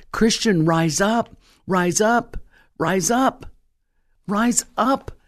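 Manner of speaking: preacher's rising battle cry; rallying call. Real, not acted. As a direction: rouse the crowd, each call climbing.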